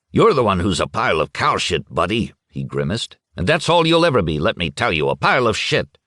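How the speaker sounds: spitting insults, barely held in; mild mock rage. Schmidt grabs Futaki's coat and berates him, a touch angry. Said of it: "Schmidt lost his head entirely".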